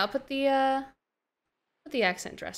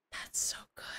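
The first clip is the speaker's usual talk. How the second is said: hushed, whispered amazement; awed whisper; 8 dB quieter, faster than usual. Real, not acted. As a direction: whisper it in real awe. Awed by the tool working.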